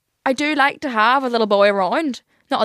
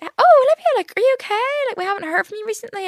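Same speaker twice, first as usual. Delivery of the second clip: mum's worried voice; 13 semitones higher than usual. Retold or imitated. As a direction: play the fussing mother calling to check in. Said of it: "she'd be like".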